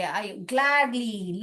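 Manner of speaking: slow, stretched words; showing how gladly she teaches, slowly. Shown as a demonstration. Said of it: "मुझे बहुत खुशी होती है".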